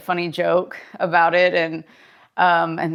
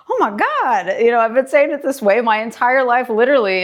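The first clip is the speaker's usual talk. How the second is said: mock surprise; 4 semitones higher than usual. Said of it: "they're like".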